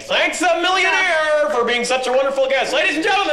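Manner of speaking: loud, high grand sign-off; showbiz announcer. Acted. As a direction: announce the guest to the crowd. Host's closing announcement.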